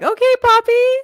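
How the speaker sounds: very high, loud doting mom. Retold or imitated.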